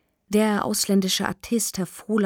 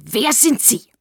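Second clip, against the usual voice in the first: loud, raised, furious demand; enraged howl; 5 semitones higher, 6 dB louder, slower than usual. A narrator's reading. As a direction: howl the question in a rage. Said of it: "wutentbrannt den Sessel ab und heulte". (wutentbrannt heulend)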